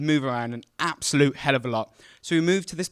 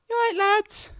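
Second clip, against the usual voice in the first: much higher, lightly voiced cheerful mum; mum at the door; 3 dB louder than usual. Mum greeting two boys at the front door, high and cheerful. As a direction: chirp it like a friendly mum.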